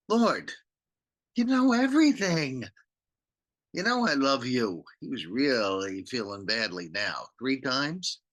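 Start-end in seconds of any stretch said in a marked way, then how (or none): 0.1-4.9 pained plea. Acted. Peter's pained third answer.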